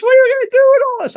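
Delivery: high, loud, rushed wife impression; quick high-pitched retold demand; imitating his wife. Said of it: "My wife, she goes".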